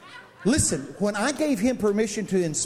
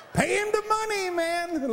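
high, laughing call to pay him; excited call-out; 12 semitones higher than usual. Real, not acted. Preacher calling out to the crowd, high and laughing. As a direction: whoop it out with a laugh. Shouting amen-style response.

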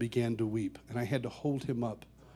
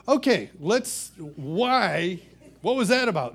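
high, slow startled protest; taken aback; 5 semitones higher, 7 dB louder, slower than usual. Retold or imitated. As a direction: recoil at the surprise kiss. Kissed by Brian, taken aback.

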